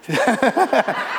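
high chuckle; spontaneous run of chuckles; amused laugh. Real, not acted. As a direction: laugh at your own aside. Joking about James from Britain.

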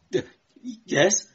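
high, halting stammer; nervous clerk. A narrator's reading. Clerk answering his interrogator, nervous stammer. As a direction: stumble onto the word, scared. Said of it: "clerk's voice was shrill with taut nerves".

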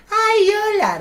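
shrill high mock desperate hello; desperate girl. Mimicking the desperate girl at the door, high squeal. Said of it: "re desesperada".

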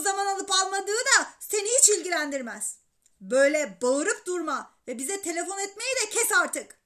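loud, scolding father on the phone; angry shouting. A narrator's reading. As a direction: yell warnings at a pestering child. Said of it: "Yine bağırmaya başladı". (öfkeyle bağırıp azarlayarak)

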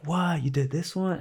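dad amazed at his son's video; stunned dad. Retold or imitated. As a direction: react like the dad can't believe it. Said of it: "And he's like".

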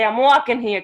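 lightly quoted New York "I'm walking here"; New York line. Retold or imitated. Explaining what she doesn't say, light New York accent. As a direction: quote the cliché with a slight accent. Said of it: "I don't say".

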